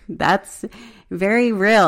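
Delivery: trying not to cry, voice trembling; shaky voice. Real, not acted. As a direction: voice shaking, holding back tears. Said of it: "my voice is shaking because I'm trying not to cry".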